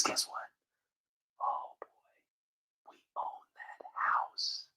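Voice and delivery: slow, sinister whisper; conspiratorial whisper. Pretend. Vlogger voices government bigwigs gloating in a slow, sinister whisper. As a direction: hiss it like a villain's secret.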